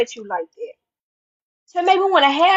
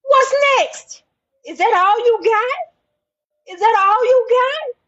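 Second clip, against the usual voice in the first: defiant taunt; 7 semitones higher than usual. Real, not acted. Defiant taunting of her enemies.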